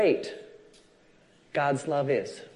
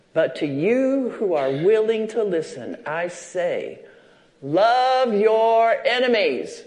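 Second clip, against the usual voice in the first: scripture reading; 5 semitones higher, 5 dB louder, slower than usual. Acted. Reads Luke, building to command.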